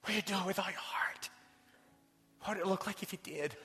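hushed, intimate whisper of the question; whispered challenge. Real, not acted. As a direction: whisper it straight to each listener. Repeats coach's question to congregation.

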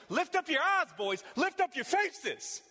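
Jesus urging the disciples to look up; rousing exhortation. Acted. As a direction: lift them up with the command.

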